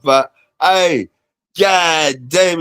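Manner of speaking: ordinary talk, nothing marked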